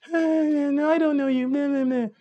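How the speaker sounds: high, whiny mock girl voice; mock girl reply. Coach mimicking the girl's brushoff in high mock voice. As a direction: mimic her dismissive answer high and nasal-free.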